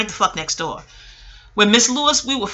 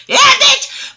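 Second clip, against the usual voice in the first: laughing shout; 10 dB louder than usual. Real, not acted. Laughing outburst at own joke.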